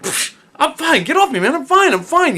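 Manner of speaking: brushing it off. Retold or imitated. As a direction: wave him off like it's nothing. Brushing off friend after blackout.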